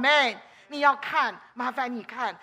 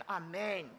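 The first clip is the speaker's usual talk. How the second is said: usual speaking voice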